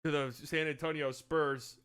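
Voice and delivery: mock TV draft announcer. Announcing the Spurs' pick to friends, mock broadcaster.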